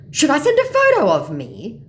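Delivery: high, loud scammer offer; eager mock scammer. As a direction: offer it loudly in caricature.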